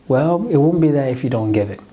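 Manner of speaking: usual speaking voice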